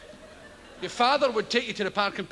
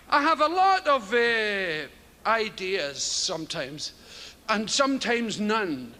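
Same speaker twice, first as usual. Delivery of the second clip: ordinary talk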